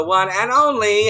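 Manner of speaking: drawn-out high shouted name; big announcer intro. Acted. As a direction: announce her like a headliner. Announcing the guest's entrance.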